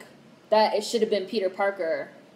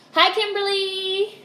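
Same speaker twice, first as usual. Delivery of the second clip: sing-song greeting; 11 semitones higher, 3 dB louder, slower than usual. Real, not acted. Greeting a viewer.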